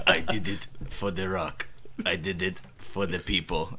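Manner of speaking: low, quiet, flat-toned promo read; a hint of a dull wrestler; Roman Reigns impression. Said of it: "That's what he sounds like".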